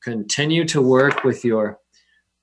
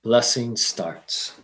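solemn announcement; 3 semitones lower, 5 dB quieter, much slower than usual. Acted. Announces the blessing.

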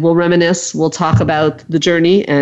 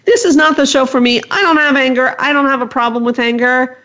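listener in denial; 7 semitones higher than usual. Retold or imitated. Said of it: "if you're listening and saying".

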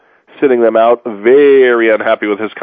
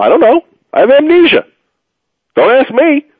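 faking amnesia; 13 semitones higher than usual. Pretend. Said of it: "And you claim amnesia".

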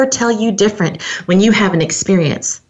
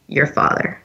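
soft, low, calm reply; 5 semitones lower, 5 dB quieter than usual. Retold or imitated. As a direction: imitate a mentor's quiet composure. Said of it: "he very calmly said".

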